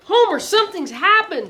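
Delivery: high, loud alarmed cry; shouted. A narrator's reading. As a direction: blurt it out in a panic. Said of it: "Augie shouted".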